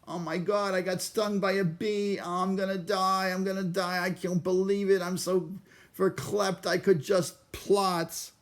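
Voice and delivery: high, whiny neurotic New Yorker voice; whiny Woody Allen. Retold or imitated. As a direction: play a panicky nebbish sure he's dying. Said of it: "my Woody Allen moment".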